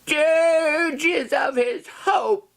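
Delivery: high, slow wailing cry; dramatic shout. Acted. Reader wailing the raven's dirge aloud. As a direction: fling the line out high and slow.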